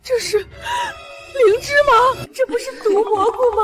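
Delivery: alarmed. Acted. Mother alarmed at poison mushroom.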